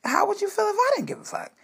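high-pitched, rushed indignant retort; acted-out squeal of disbelief; retold outrage. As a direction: fire the question back, high and fast. Retelling her heated reply to daughter.

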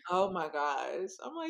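ordinary talk

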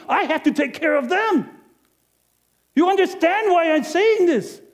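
high, loud and harried; a busy innkeeper complaining to the audience, high and loud; overwhelmed rant. Acted. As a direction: list the chores like it's all too much.

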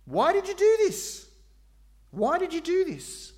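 high, loud pleading why; anguished cry. Acted. God pleading loudly with his people. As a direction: cry it out like a grieved father.

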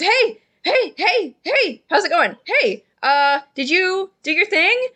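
high, bouncy, overexcited party voice; giddy drunk Phineas. Acted. Drunk party guest greeting his friend, high and giddy. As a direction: gush at your friend like a happy drunk. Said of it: "And then he says".

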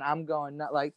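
usual speaking voice

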